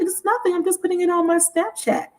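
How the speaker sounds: light, high imitation of a woman; dismissive woman imitation. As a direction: play it off like nothing is happening. Woman brushes off being caught recording.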